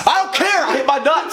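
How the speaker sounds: high pained voice. Real, not acted. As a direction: squeak it out in pain. Hurt himself, pained.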